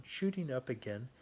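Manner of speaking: ordinary delivery, nothing marked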